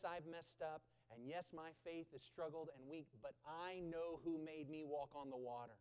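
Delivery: ordinary talk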